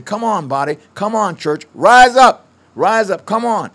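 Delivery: rising shouts of come on and rise up; rally them like a cheering crowd. Retold or imitated.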